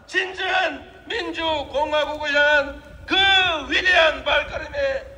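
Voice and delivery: building rally cry to the crowd; rousing shout. Real, not acted. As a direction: call out to the crowd, full force.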